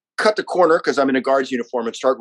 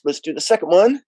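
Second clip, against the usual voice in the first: usual speaking voice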